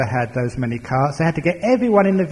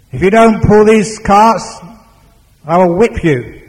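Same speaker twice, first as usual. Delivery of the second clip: loud, raised-pitch warning; angry threat; 6 semitones higher, 8 dB louder than usual. Acted. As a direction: threaten loudly, hitting each word. Master threatens the unmoving ox.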